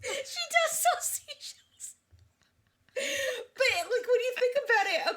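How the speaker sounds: high-pitched teary laughter, words squeezed out; laughing fit. Real, not acted. Host breaking down laughing at seashell joke, high and teary. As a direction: laugh until it nearly turns to crying.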